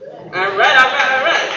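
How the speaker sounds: hyping the crowd. Real, not acted.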